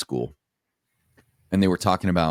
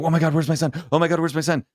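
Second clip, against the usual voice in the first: high-pitched mock panic; panicked mother; 10 semitones higher, faster than usual. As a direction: imitate a frantic mother, pitched way up. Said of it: "then she comes running out".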